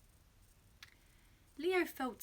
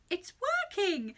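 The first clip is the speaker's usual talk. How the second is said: high, drawn-out cry of delight; excited cry; 9 semitones higher, 4 dB louder than usual. A narrator's reading. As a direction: exclaim slowly and high as it succeeds. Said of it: "he cried".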